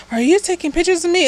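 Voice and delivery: high, rushed, alarmed question; suspicious alarm. Retold or imitated. Haley spotting the camera on his neck, high and alarmed. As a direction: catch him out, startled and wary. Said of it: "and she goes".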